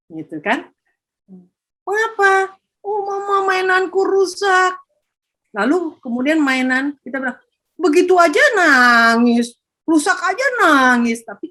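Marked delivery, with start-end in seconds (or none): 1.9-4.7 put-on higher, whiny little kid; child's voice. Child answering mother. 7.7-11.2 dismissive scold. Retold or imitated. Parent dismissing child's tears.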